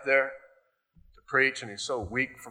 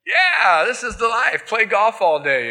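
higher, louder smug slacker voice; mock carefree; 9 semitones higher, 6 dB louder than usual. Lazy pastor gloating about golf, mock carefree. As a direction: gloat about the easy life.

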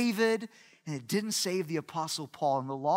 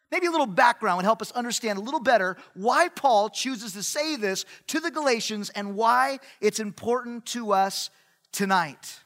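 normal talking voice

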